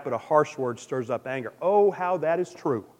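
ordinary delivery

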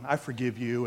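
low, softened tender parent voice; soft forgiveness. Acted. Parent softening to a sorry child, acted tenderness. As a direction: melt and forgive quietly. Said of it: "you get all soft inside".

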